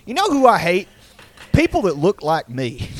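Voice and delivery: a hint of a Texas good ol' boy; Texas accent. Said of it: "like, in a Texas accent".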